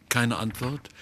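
ordinary delivery

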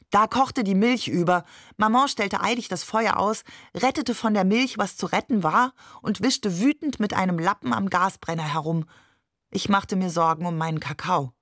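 plain reading voice

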